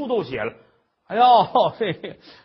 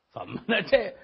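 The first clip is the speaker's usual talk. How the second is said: ordinary delivery, nothing marked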